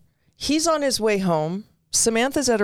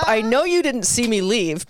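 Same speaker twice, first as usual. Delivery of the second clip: ordinary talk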